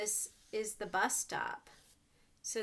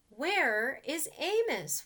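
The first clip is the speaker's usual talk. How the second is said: a slow, high, louder worried question; worried wondering; 5 semitones higher, 6 dB louder, slower than usual. Acted. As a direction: wonder aloud where the friend is. Animals wonder, voiced.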